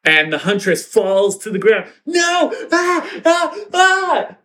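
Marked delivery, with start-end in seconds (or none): 2.0-4.4 high-pitched falling cries of "no" and "ah"; mock scream. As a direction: cry out in hammed-up distress. Huntress falls, cries out in story.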